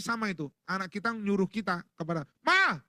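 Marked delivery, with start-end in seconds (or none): 2.4-2.8 high, loud copycat shout; short sharp call across the house; child's yell. Acted. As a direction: yell for mum like a demanding kid. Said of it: "Teriak juga".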